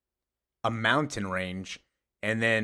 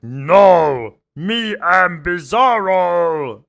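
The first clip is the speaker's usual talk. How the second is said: loud, slow, higher brute voice; booming Bizarro; 8 semitones higher, 11 dB louder, much slower than usual. Retold or imitated. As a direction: boom it out like a clumsy brute. Bizarro booming his name.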